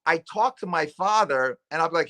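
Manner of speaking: ordinary talk, nothing marked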